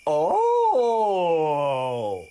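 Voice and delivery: loud, high, long drawn-out oh; amazed frog. Acted. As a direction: let the oh ring out and slide down. Storyteller voices frog's reaction.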